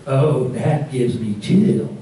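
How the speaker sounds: a gentle mock Southern lilt, drawn out slowly; Southern accent, lightly imitated and slow. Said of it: "wonderful Southern accent".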